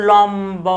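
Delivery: লম্ব with a drawn-out lom, then bo; unaltered b. Shown as a demonstration. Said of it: "বয়ের উচ্চারণ অবিকৃত থাকে".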